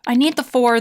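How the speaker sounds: normal talking voice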